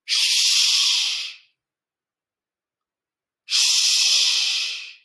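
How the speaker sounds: long, loud "Shh" hiss; sh sound demo. Shown as a demonstration. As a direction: hold "Shh" for learners to copy. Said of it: "listen and repeat after me".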